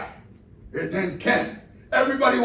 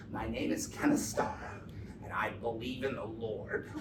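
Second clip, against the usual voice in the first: much lower, hushed pious Starr voice; mock Kenneth Starr; 8 semitones lower, 7 dB quieter than usual. Starr dictating his report to the world, low and soft.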